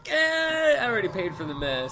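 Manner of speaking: high dismissive eh, then breezy; smug shrug. Retold or imitated. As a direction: brush it off like a rogue. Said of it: "It's like".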